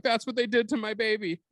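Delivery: high, wailing granny voice; heartbroken old lady. Pretend. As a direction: cry out over her lost baby. Old lady seeing the movie.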